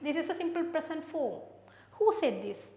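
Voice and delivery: normal talking voice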